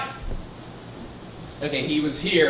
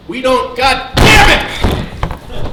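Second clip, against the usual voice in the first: frustrated outburst; 8 semitones higher, 11 dB louder, much slower than usual. Acted.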